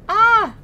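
sudden high, loud yelp; startled yelp. Real, not acted. Player caught off guard in the game, startled yelp. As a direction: cry out in surprise.

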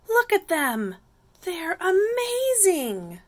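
high, drawn-out storybook marvelling; admiring excitement. A narrator's reading. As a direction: gush in awe, stretching the last word. Slug admiring the snails.

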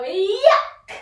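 high loud rising yuck; loud disgusted yuck. Shown as a demonstration. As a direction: spit it out in disgust. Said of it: "we've just swallowed a fly, so we're gonna go".

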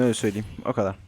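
usual speaking voice

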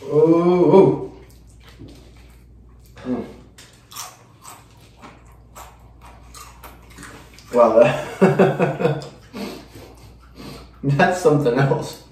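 pained oohs from the burn; laughing through the spice heat; mouth burning from spicy food. Real, not acted. Heat building after chip.

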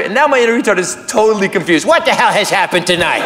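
ordinary delivery

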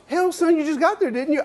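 high, amused Texas boss. Retold or imitated. Owner teases the kid calling from the airport, high country voice. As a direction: tease him like a chuckling old owner. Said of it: "And he goes".

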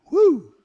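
high, loud "woo"; excited whoop. Retold or imitated. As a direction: whoop like someone bolting off at speed. Imitating Elijah taking off running.

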